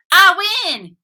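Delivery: high, loud win call; gloating. Acted. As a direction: crow about winning. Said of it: "he said".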